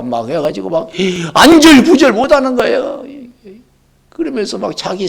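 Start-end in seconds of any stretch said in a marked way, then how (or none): 1.3-3.1 agitated. Acted. Mimics restless anxiety.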